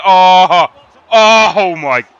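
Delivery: loud cry at a near miss; shocked yell. Real, not acted. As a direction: yell out in disbelief. Near goal saved.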